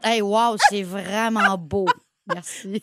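mock admiration. Woman mimicking thanks for an unwanted photo, mock admiration. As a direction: feign being impressed, slowly and low. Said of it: "Jamais que j'ai fait".

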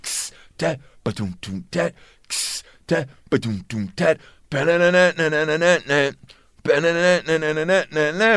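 hushed imitation of drums and guitar; beatboxing the record.

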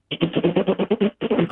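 a high-pitched laugh that breaks out; high burst of laughter. Real, not acted. Laughing high at his own story of the sign thieves.

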